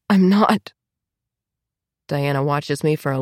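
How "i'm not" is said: cracked, broken denial; voice cracking. Acted. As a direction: let the voice crack. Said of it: "My voice cracks".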